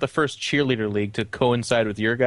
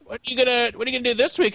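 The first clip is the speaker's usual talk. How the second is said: high mocking voice; 10 semitones higher, much faster than usual. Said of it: "You're like".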